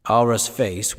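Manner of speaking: plain reading voice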